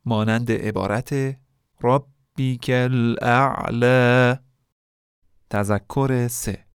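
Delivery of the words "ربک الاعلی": ربک الاعلی recited slowly and louder, final vowel held two beats; two-beat stretch. Shown as a demonstration. Teacher recites the verse for students, final vowel held two beats. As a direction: chant the last vowel of الاعلی for two counts. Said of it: "به صورت دو ضربی بخونیم".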